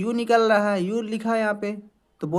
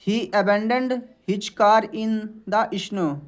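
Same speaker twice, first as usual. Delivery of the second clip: English read very slowly, word by word; slow dictation; much slower than usual. Shown as a demonstration. Teacher reading a definition aloud to students, very slow. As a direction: spell it out slowly for the class. Reads example sentence slowly.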